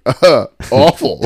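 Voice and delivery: slow, high deadpan groan; mock pained dismissal; drawn-out mock verdict. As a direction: drag it out like the answer is obvious. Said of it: "I was like".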